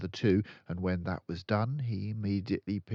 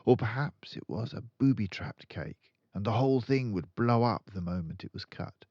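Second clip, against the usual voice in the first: ordinary delivery, nothing marked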